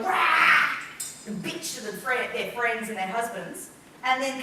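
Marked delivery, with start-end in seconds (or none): 0.0-0.7 loud, low outburst of rage; mock angry roar. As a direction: let out a pretend furious "rah". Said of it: "The first example where they go".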